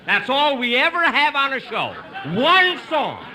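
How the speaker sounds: high, loud, laughing objection; exasperated loud protest. Acted. Host stops the singer's encore, loud and exasperated. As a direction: cut him off, half laughing.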